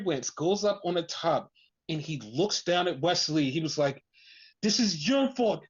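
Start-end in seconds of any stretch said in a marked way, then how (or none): 4.6-5.6 raised-pitch mock accusation; retold accusation. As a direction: act out an anguished accusation. Retelling wrestler's emotional accusation.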